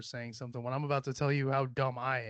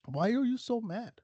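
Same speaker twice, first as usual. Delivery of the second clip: same question asked again, pitched up with a teasing lilt; higher mocking repeat; 6 semitones higher than usual. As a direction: tease them, higher. Mocking angry commenters.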